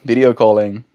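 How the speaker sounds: normal talking voice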